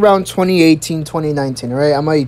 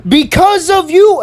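high, slow accusation; retold raised voice; 10 semitones higher, 4 dB louder than usual. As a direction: imitate the principal raising her voice. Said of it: "she literally raised her voice".

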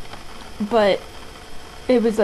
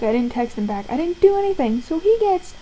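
ordinary talk, nothing marked

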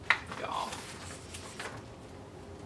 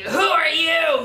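snarled; 3 semitones higher, 7 dB louder than usual. Acted. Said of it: "he snarled".

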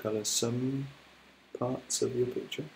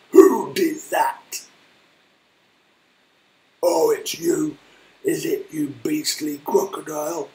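big deep voice; 4 semitones higher, 8 dB louder than usual. Acted. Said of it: "said Trunky in his big, deep voice".

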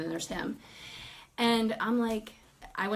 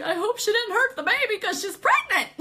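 high-pitched retold worry; mock alarm; 11 semitones higher, 4 dB louder than usual. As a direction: let the pitch climb in exaggerated alarm. Retold alarm over the baby.

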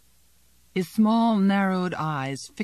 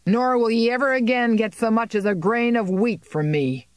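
higher, louder furious farmer's vow; harsh defiance; 6 semitones higher, 4 dB louder than usual. A narrator's reading. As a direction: throw the refusal at the landlord. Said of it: "said Klaas harshly".

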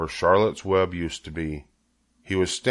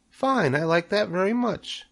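high, pleased little voice; tiny creature, pitched way up; third little spider; 12 semitones higher than usual. A narrator's reading. Third spider accepts her name, high little voice. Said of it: "said the third spider".

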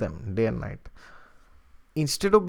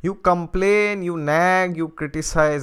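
mock nagging tone; 5 semitones higher, 7 dB louder, slower than usual. Mocking naggy partner.

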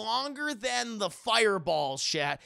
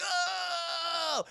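high, drawn-out agonized wail; pained cry; 9 semitones higher than usual. Retold or imitated. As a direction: cry out as if crushed. Victim being crushed.

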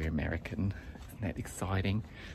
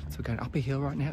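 ordinary delivery

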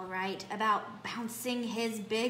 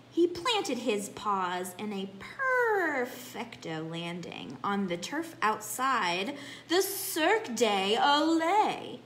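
ordinary delivery, nothing marked

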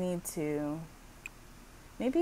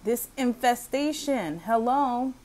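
loud, higher-pitched scolding; play-acted angry demand; 5 semitones higher, 7 dB louder than usual. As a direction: berate the landlord in a pretend row. Acted tenant scolding the landlord.

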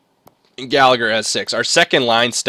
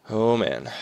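ordinary delivery

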